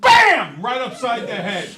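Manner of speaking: loud, slow, laughing joke of a slap; mock smack. Teacher joking to a student about a slap, loud. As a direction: ham up hitting the toddler, loud and laughing.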